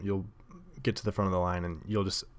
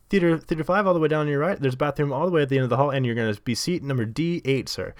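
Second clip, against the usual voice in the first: loud, raised usher patter; imitating ticket guy; 5 semitones higher, 4 dB louder, faster than usual. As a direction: reel off directions like an eager usher. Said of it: "He says".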